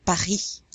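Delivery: "Paris" ending in ee, throaty R, silent S; French pronunciation. Shown as a demonstration. Teacher shows the I as E, French "Paris" pa-REE. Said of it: "you're gonna pronounce it an E".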